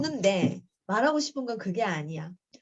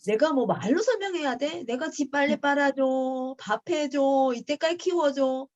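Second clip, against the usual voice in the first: raised, higher-pitched mock tirade; indignant rant; 5 semitones higher, 3 dB louder than usual. Mother rants about cooking and laundry for her ungrateful son, indignant. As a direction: list grievances in exasperated outrage.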